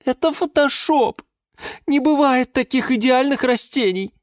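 high-pitched tearful complaint; sobbing geranium. A narrator's reading. Geranium complains about rival catalogue plants, sobbing. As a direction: whimper it in a high character voice. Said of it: "всхлипнула вдруг герань Антонина".

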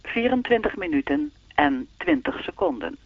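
even, measured time announcement; speaking clock voice. Acted. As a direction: read the time like the recorded clock. (zoals de sprekende klok)

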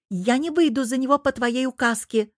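combative, high-pitched protest; militant cry. A narrator's reading. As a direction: shout it back defiantly. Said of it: "воинственно прокричала зараза".

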